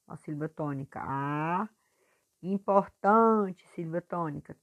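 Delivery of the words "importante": "Importante" slow, with TAN drawn out and louder; teacher pulling out TAN in "Importante"; stretched stressed syllable. Shown as a demonstration. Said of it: "puxando mais a sílaba forte".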